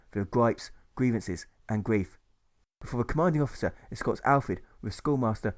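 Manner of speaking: ordinary delivery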